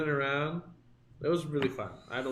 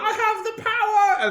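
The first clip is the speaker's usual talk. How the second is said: much higher, louder proud yell; triumphant shout; 6 dB louder than usual. Retold or imitated. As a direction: bellow it like a hero. Retelling drunk lightsaber antics.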